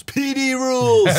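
P D rules! shouted high and loud; excited cheer. Pretend. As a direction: cheer it like a chant. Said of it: "they said P D rules".

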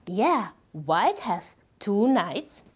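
ordinary delivery

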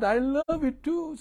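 high, loud, slow and beaming; a big, glowing I love you; joyful declaration. Retold or imitated. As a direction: sing it out happily to them. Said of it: "When I said".

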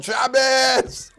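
sharp high shout of Shabbos at a passing car; yelled Shabbos. Retold or imitated. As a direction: belt it out like an angry old man at a car. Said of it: "and he yelled".

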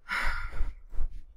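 long, heavy breathy sigh; exasperated sigh. Real, not acted. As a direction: let out a big sigh of letdown. Weary reaction to a useless reward.

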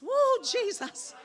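high, loud, drawn-out church mother whoop; mock pious wail, pitched high. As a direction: imitate an old-time church mother crying out. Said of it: "we had some church mothers".

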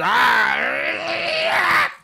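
startled hammed-up shriek; faked death cry; mock scream.

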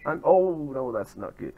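usual speaking voice

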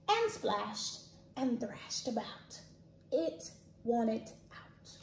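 lively storybook read-aloud; animated picture book reading; reading to children. Acted.

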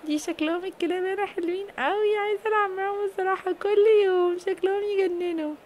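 put-on a little girl charmed by the dogs; child's voice. Little girl telling mom she loves the dogs.

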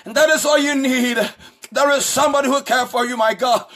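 usual speaking voice

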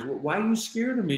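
high-pitched, retold tearful question. Husband asks his wife why she fears him, retold tearful. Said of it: "I had tears in my eyes".

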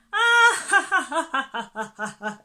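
high, loud slow ha-ha laughter. A narrator's reading. The animals laughing at the wasp, loud high laughter. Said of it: "laughed all the other animals".

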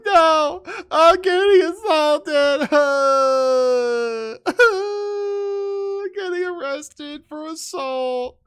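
very high, slow, whiny mockery; mocking woman voice. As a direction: squeal like a flailing drama queen.

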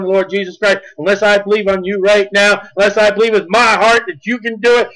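usual speaking voice

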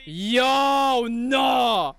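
long, stretched cry of protest; disbelieving shout. Real, not acted. Viewer seeing him try Gojo's move, disbelieving shout. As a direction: draw both words out, aghast.

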